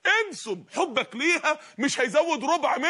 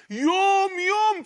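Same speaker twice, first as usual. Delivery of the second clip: emphatic cry; 7 semitones higher, 3 dB louder, much slower than usual. Acted. Preacher stresses the day.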